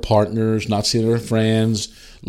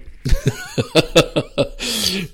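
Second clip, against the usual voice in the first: high hearty burst of laughter; real laugh at his own punchline; high laugh trailing down; 12 semitones higher than usual. Real, not acted.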